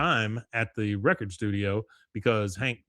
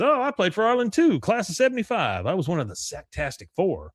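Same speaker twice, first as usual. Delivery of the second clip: high, eager retelling of the chairman; excited board chairman; 7 semitones higher than usual. Retold or imitated. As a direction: pitch it up like a thrilled old teammate. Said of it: "The guy says".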